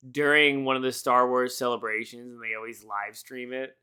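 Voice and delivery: ordinary talk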